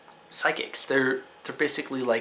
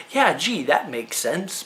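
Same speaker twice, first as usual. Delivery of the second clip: sarcastic agreement; 2 semitones higher, slower than usual. Acted. Fan mocking a card maker's logic, sarcastic. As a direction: pretend it makes total sense, sarcastically.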